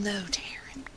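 breathy whispered tease; soft whispered nudge; whispered aside. Real, not acted. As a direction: whisper her name teasingly. Teasing aside.

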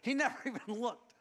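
cracking up telling it; laughing. Real, not acted. Laughing recounting boy's retreat.

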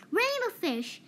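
high call across the water. A narrator's reading. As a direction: call out to get his attention. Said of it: "he called".

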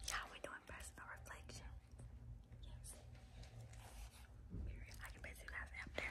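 very soft whisper. Real, not acted. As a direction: whisper during quiet time. Whispering during personal reflection.

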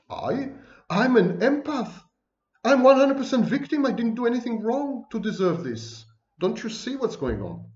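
high-pitched mock protest of innocence; self-righteous victim. Lecturer mimicking a borderline claiming to be an empath, indignant victim act. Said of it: "it's a self-aggrandizing, self-slapped label".

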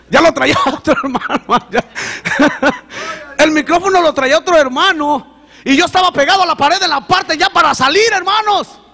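high, loud, fervent testimony; rising, loud pulpit voice; preaching at full force. Acted. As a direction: preach it out to the congregation. Laughing, loud preaching of climax.

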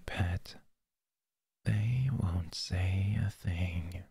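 breathy close-mic whisper. Acted. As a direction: whisper it right into the ear. Whispered roleplay.

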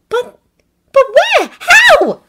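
put-on high, loud, stumbling girl's voice; Hermione's frightened questions. A narrator's reading. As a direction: fire the questions in alarm. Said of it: "Hermione asked".

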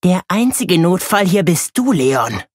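a hint of a hiss, sister sniping at her brother. A narrator's reading. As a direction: snipe at him with a sneer. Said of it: "sie zischt mich an".

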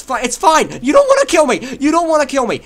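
high, loud frantic protest. Real, not acted. Pleading with attacker in game.

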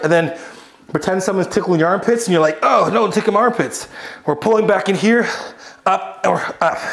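ordinary talk, nothing marked

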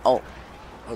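usual speaking voice

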